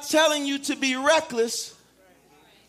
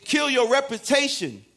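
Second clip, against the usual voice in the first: normal talking voice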